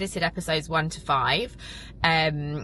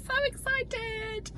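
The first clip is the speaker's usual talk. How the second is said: high, soft, slow squeal; squealed excitement; 4 dB quieter, much slower than usual. Real, not acted. Telling viewers about coming months, high soft squeal. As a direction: squeak it out, bursting with joy. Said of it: "I'm so excited".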